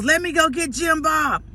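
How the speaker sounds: put-on high-pitched eager country kid; little country boy. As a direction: imitate a small boy offering to fetch help. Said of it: "So he goes".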